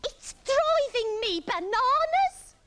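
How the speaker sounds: high, slow, exasperated yell; Iggy yelling. A narrator's reading. Iggy maddened by the itch, exasperated yell. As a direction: yell it, stretching every word. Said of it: "Iggy yelled".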